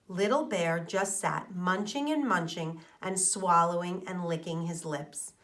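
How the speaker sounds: plain reading voice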